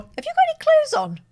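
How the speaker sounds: high, startled question; shocked. Real, not acted. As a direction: blurt it out in disbelief.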